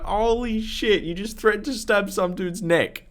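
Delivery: high-pitched, incredulous retelling; shocked disbelief. Retold or imitated. Storyteller to tradesman after his threat to stab, high shocked disbelief. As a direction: exclaim in amused shock, pitched right up.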